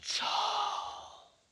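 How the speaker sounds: slow wizard incantation, ending in a whisper; muttered magic spell. A narrator's reading. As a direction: chant the spell like a sleepy wizard. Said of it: "muttered some magic words under his breath".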